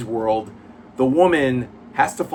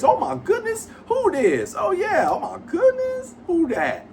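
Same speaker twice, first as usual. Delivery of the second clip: very high, exaggerated squealing caricature voice; caricature Falcon voice. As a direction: push way up into a giggly falsetto caricature. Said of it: "I use that for his voice".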